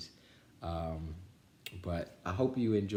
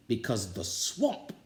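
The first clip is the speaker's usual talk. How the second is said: a sinister promise; spooky coach threat; 5 semitones higher, slower than usual. A narrator's reading. Coach warns the team the swamp is watching, slow eerie threat.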